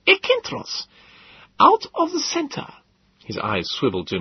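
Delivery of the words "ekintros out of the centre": put-on high, slow, accented lecturing; pedantic professor. Gödel lectures on the word's meaning, high and slow. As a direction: spell out the Greek, pedantic.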